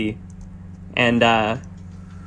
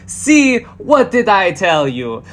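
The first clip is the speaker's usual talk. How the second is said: excited showman voice; 6 semitones higher than usual. Acted. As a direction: brag like a flashy showman. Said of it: "turning to the father is just like".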